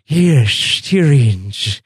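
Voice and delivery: slow, spit-filled courtier voice; spitty Varys voice. Retold or imitated. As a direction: speak slowly as if your mouth is full of spit. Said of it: "sounds like his mouth is just filled with spit".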